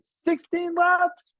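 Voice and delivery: proud cry of sixteen laps to the audience; excited exclamation. Real, not acted. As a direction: shout it out with pride.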